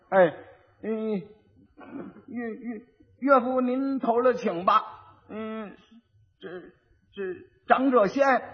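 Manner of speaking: halting, stuttered, rote recitation with long gaps; simpleton reciting. Acted. Simpleton repeating his wife's lesson, stammering laboriously. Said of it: "说，说试试".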